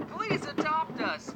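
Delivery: high, slow, soft little plea; cute hamster voice. Acted. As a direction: beg sweetly like a tiny pet. Reading cute sign on cage.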